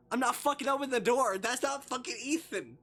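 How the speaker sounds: loud, high-pitched outraged refusal; alarmed shouting. Real, not acted. Player yelling at the voice behind the door, alarmed shout. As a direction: shout it in disbelief at the game.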